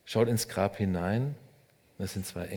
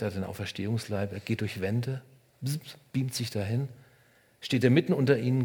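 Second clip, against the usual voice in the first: normal talking voice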